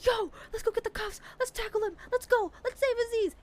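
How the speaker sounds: high, excited mock yell to charge in; rallying the crew. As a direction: hype everyone up, high-pitched and urgent. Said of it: "we were all like".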